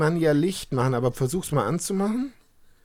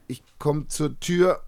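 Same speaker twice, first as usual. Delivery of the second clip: ordinary talk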